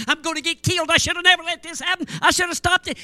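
high, loud, fretting; panicked apology. Retold or imitated. Assistant pastor to the angry preacher, a high panicked apology. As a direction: squeal it out in a panic.

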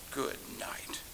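breathed, voiceless, soft; whispered aside. Real, not acted. Preacher to himself after the rebuke, a whispered aside. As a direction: whisper it in disbelief.

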